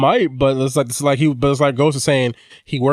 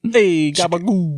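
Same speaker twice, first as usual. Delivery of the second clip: mock Italian accent; 6 semitones higher, much slower than usual. Mock Italian slang after Italians mentioned.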